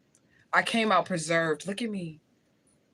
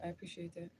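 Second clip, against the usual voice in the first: whisper; 11 dB quieter than usual. Real, not acted. Thanking viewer in whisper.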